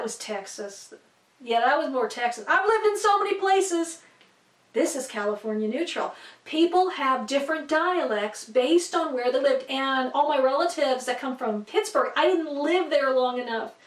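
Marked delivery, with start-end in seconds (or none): none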